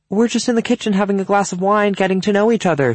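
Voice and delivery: high, lifted hostess voice; cheery hostess. A narrator's reading. Cynthia answering from the kitchen with wine, cheery. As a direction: call back sociably.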